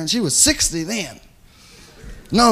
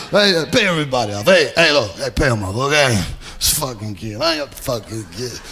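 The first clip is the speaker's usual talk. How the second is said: low-pitched, offhand mobster; mobster boss; 8 semitones lower than usual. Retold or imitated. Mobster boss orders the pay handed out, low offhand imitation. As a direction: drop low and settle it like the boss. Mobster relents, voiced by the teller.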